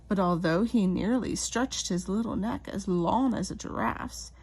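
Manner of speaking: plain reading voice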